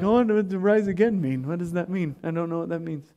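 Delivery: soft, trailing-off puzzlement; confused muttering. Acted. Acting out baffled disciples, muttering.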